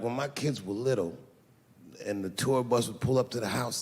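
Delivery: ordinary talk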